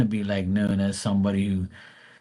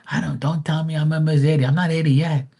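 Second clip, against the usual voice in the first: higher, fast, indignant mom voice; mock offended mom; 7 semitones higher, faster than usual. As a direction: play mom bristling at her age. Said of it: "She gets mad".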